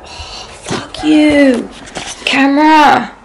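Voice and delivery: high, slow, hammed-up swear; mock outburst at camera. Vlogger fake-cursing her broken camera, mock outburst. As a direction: pretend to rage at the camera, drawn out and high.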